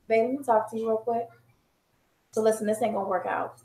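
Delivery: soft, low and gentle breakup voice; end of relationship voice. Shown as a demonstration. Wife breaking up with husband, soft and calm. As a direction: ease him out calmly and quietly. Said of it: "this is my end of relationship voice".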